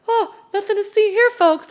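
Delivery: high, loud, breezy mock-innocent voice; innocent kneecap. Kneecap popping back as if nothing happened, high mock-innocent voice. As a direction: play it like nothing happened, all high and airy.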